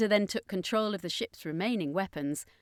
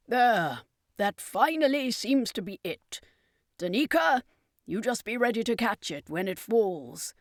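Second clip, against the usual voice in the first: circus master, higher and louder, pleased the work is done; lifted character voice calling to Danica; 4 semitones higher, 4 dB louder than usual. Acted.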